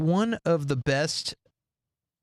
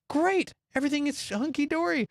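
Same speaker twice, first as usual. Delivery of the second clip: high-pitched mock cheeriness; breezy imitation, pitched high; acted cheer; 9 semitones higher than usual. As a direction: play the carefree couple, voice up. Acting out the couple's cheerful reaction.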